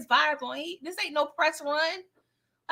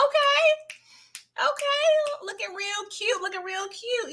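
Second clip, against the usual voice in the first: much higher, sing-song encouraging coo; hype photographer; 10 semitones higher, slower than usual. Retold or imitated. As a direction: hype the girls up while snapping a picture. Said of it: "I was like".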